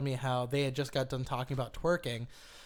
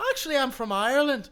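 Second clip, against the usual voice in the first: higher, louder fake Irish accent; bad Irish accent; 8 semitones higher, 5 dB louder than usual. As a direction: put on a terrible Irish accent. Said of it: "It's like the worst fucking accent".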